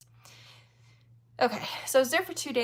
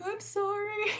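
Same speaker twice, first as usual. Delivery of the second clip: sheepish apology; 12 semitones higher than usual. Retold or imitated. Bumping into Eastwood, nervously laughing.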